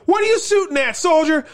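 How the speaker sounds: high, barked question to a soldier; officer demanding. Retold or imitated. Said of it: "Martin Sheen's like".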